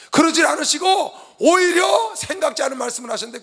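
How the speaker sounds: loud, high-pitched pulpit exhortation; real impassioned sermon shout; full-force preaching. Real, not acted. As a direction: proclaim it fervently to the congregation.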